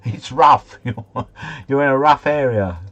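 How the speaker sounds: imitating the man with his hands up, loud and high; mock warning. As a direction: ham up a local telling you it's rough round here, with a laugh breaking in. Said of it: "he says".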